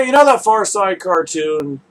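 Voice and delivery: ordinary talk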